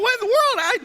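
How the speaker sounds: high, loud frantic yell; hangry outburst. Retold or imitated. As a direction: shout it, starving and furious.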